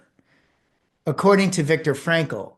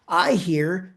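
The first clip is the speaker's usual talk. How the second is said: ordinary delivery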